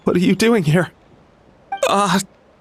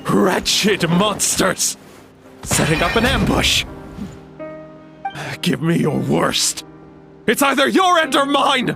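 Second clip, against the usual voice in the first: battle cry. Acted. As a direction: roar it at the enemy. Hallucinating warrior battles imagined monsters.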